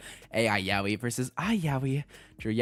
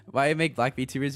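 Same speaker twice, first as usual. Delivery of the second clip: usual speaking voice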